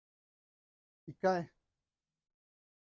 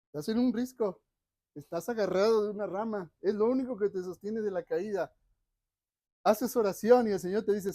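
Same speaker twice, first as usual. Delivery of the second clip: higher, louder urgent sermon voice; fervent preaching; 3 semitones higher, 6 dB louder than usual. Acted. Preacher putting listeners on the cliff, urgent fervent preaching. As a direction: build the tension, push it out to the crowd.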